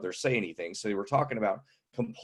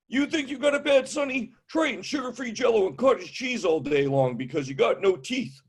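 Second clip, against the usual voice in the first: put-on high, scolding old-timer; cranky old man; 10 semitones higher than usual. Old man scolding the young comedian, high and cranky. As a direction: lecture the youngster like a cranky grandpa. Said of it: "they got macho on me".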